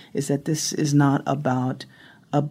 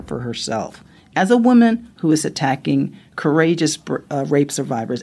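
ordinary delivery

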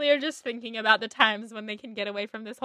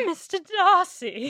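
slow, higher, swooning British "Mister Darcy", rising on "Darcy"; British accent; 7 semitones higher, 5 dB louder, slower than usual. Retold or imitated. Host redoing her half bad British "Mister Darcy", slow swooning British accent. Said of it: "half bad British accent".

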